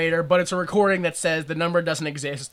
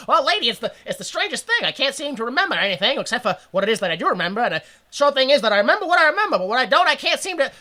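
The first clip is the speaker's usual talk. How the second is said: high, fast bumbling fifties soldier; rambling, high-pitched leading man; soldier monologue; 6 semitones higher, faster than usual. Acted. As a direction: ramble like a confused old-movie hero. Soldier's amnesia monologue acted.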